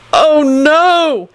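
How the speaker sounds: high, drawn-out cry of alarm; sudden shout. A narrator's reading. Neville suddenly realising his mistake, shouting. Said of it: "he shouted suddenly".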